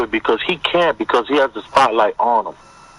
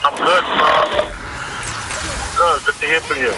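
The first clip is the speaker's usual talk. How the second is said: echoing tunnel. Real, not acted. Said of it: "Your phone sounds crazy".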